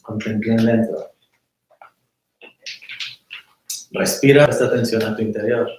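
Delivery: calm, unhurried meditation voice with long pauses; guided meditation. Acted. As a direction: guide them inward, letting silence sit.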